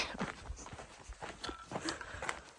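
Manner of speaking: high emotional laugh. Real, not acted. Overwhelmed at the border.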